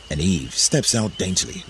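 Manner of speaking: plain reading voice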